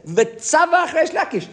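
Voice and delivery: high, slow, laughing build-up; relishing the big moment; dramatic retelling. Retold or imitated. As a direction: savor the drama of the shout.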